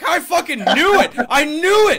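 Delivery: high, very loud vindicated yell; triumphant shout. Retold or imitated. Worker hearing his car guess confirmed, triumphant shout. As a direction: shout it, thrilled to be proved right.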